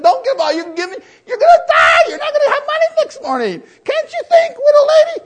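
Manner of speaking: shrill, very high, loud mock shout; hammed-up pleading cry; mock scolding Jesus. Preacher mocking what Jesus never said, a very high shouted plea. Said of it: "Jesus should have said".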